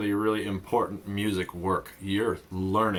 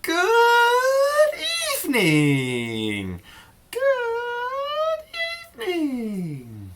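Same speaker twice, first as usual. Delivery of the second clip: high squeal sliding down, vowels stretched; 5 dB louder, much slower than usual. Shown as a demonstration. Teacher asks kids to echo her, high sliding squeal. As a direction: swoop down slowly from the very top. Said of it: "say it like this".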